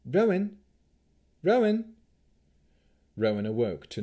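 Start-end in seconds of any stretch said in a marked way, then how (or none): 0.0-1.9 raised, higher voice calling a name; insistent call, high and loud; urgent calling. A narrator's reading. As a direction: call out to wake a sleeper. Waking someone from a dream.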